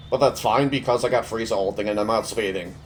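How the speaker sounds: ordinary delivery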